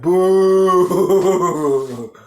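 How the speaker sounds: long, loud jeering boo; drawn-out crowd heckle; heckler's boo. Retold or imitated. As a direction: hold the boo out, letting it waver away. Retells booing a comedy set.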